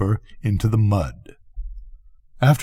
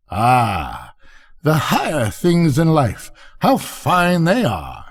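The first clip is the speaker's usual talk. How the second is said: high, sighing duck lady; musing duck; 5 semitones higher, slower than usual. Acted. Duck musing on higher things in life, dreamy. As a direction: rise high, then linger admiringly.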